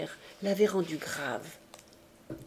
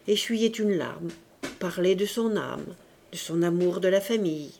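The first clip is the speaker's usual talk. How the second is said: plain reading voice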